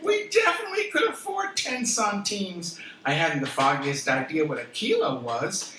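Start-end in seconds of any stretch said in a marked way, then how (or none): 0.0-2.8 high, eager burst of delight; thrilled she can afford it; excited. Retold or imitated. Tourist thrilled at the bargain price, high and excited.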